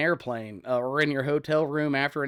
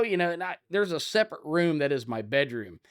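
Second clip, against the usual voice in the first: ordinary delivery, nothing marked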